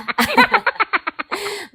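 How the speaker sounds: high-pitched real giggle; high giggle breaking out. Real, not acted. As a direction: giggle at your own joke. Laughing after teasing.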